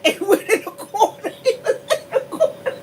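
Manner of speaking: high, helpless laugh through the words; laughing hard. Real, not acted. Cracking up at own story.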